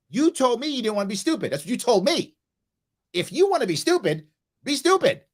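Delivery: high, playful mock-careless lecture; teasing dad voice. Shown as a demonstration. Dad showing how he talks to his daughters, teasing. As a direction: needle her, lightly exaggerated. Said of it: "I speak to my daughters just like this".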